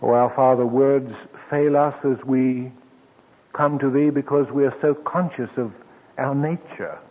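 humble spoken prayer. Acted. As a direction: pray for the whole congregation. Leads closing prayer.

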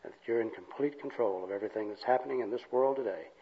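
soft, low public prayer. Real, not acted. Leads an opening prayer.

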